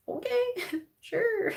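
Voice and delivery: high, slow, laughing reply; drawn-out skeptical okay through giggles; laughing disbelief. Retold or imitated. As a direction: humor them while cracking up. Skeptical retort breaking into laughter.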